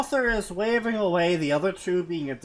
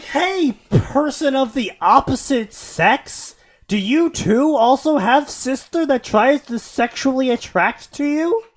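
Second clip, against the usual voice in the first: high, loud mock-innocent questioning voice; mocking Argo's question; 8 semitones higher, 9 dB louder than usual. Host parodies Argo asking the girl about sisters, mocking. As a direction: ham up the clueless question. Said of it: "But now it's like".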